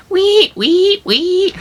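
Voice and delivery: high loud squealing wee; pig squeal. Retold or imitated. Hitchhiker mocking Franklin, high loud pig squeal. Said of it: "he's like".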